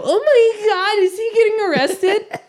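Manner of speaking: put-on high, astonished onlooker; shocked students. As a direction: imitate scandalized students in a squeaky-high voice. Students shocked at his apparent arrest.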